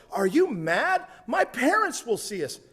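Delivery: high, alarmed protest as the startled date; horrified girl. Acted. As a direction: gasp out the alarm in a high girl's voice. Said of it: "Horrified, she replies".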